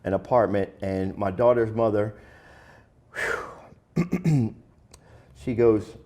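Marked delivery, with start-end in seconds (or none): 3.1-4.5 heavy exhale. Real, not acted. Reliving his partner's harsh words.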